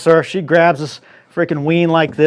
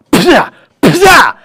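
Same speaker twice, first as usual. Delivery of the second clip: loud, high smashing sound effect; whacking noise; 9 semitones higher, 6 dB louder than usual. Retold or imitated. Hitting the tree with the bees, loud whack sound. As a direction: shout out the stick blows. Stick smashing the bee hive.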